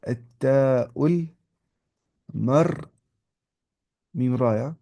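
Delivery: drawn out piece by piece; slow, segmented teacher's model; slow dictation. Shown as a demonstration. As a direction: dictate it slowly, pausing between parts.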